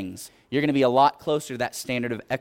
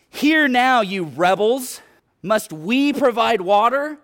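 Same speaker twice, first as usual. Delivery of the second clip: higher, louder rebuke; frustrated Moses; 9 semitones higher, 6 dB louder, slower than usual. Acted. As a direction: scold the whining people like a fed-up leader. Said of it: "Moses gets a little frustrated".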